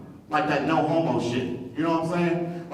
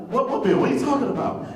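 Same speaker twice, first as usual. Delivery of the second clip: innocent confusion; 8 semitones higher than usual. Retold or imitated. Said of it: "I'd be like".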